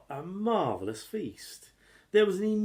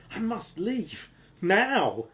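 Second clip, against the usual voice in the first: nervous thought; 8 semitones higher, 4 dB louder, slower than usual. A narrator's reading. Jack frightened, thinking.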